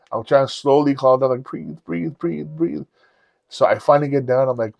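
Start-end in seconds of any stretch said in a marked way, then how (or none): 1.4-2.8 high, soft, anxious chant; scared self-soothing. Retold or imitated. Climber scared of heights calming himself, high soft panic. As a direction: coach yourself through fear under your breath. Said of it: "I'm scared".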